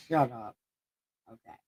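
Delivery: usual speaking voice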